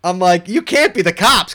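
high and loud disbelief; can't believe what you see; incredulous. Retold or imitated. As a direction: voice jumps up in amazed disbelief. Cops appear in the dark woods.

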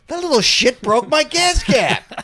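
high, loud, slow retold rant; angry outburst. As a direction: vent like a furious car owner. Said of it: "I'm like".

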